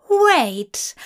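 high startled exclamation; sudden realization. A narrator's reading. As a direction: blurt it as it dawns. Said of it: "eyes popped open in surprise".

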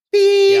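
a high, loud beep like an answering machine; imitated beep. Roommate imitating the answering machine, high, loud beep.

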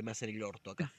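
low, muttered complaint to himself; muttered under breath. Retold or imitated. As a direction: grumble it quietly in frustration. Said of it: "y di".

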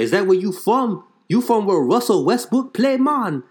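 high, Jamaican-lilted friendly question; curious island vendor asking. Retold or imitated. Vendor asks about OKC slippers.

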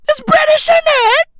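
very high, loud British yell; mock-British shouting. Comic hammering his British punchline, high shouting. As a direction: shriek the catchphrase at full tilt.

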